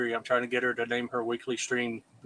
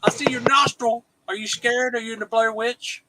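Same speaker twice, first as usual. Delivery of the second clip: teasing high mock voice; 9 semitones higher than usual. Teasing the friend on camera.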